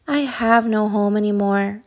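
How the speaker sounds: usual speaking voice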